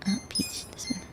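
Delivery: breathy murmur to the cat; barely voiced, almost a whisper; hushed aside. Real, not acted. Talking softly to her cat Peach, hushed.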